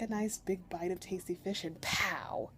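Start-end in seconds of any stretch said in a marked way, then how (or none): 1.6-2.5 mock exploding "pow"; punchy spoken explosion effect; imitated explosion. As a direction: burst out "pow" like a blast. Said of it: "That's the exploding".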